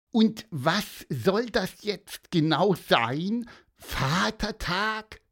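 high, slow, puzzled little bird voice; bird asking. Acted. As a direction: ask it like a curious bird. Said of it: "hakte Pieper nach".

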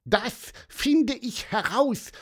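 high, squeaky, slowed announcement; eager little bird. A narrator's reading. Pieper volunteers to find the voice, eager chirp. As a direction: declare it proudly in a high bird voice.

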